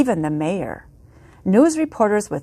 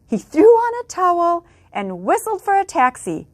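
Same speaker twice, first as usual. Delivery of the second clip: ordinary delivery, nothing marked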